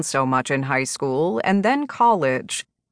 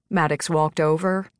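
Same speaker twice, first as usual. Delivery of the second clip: ordinary delivery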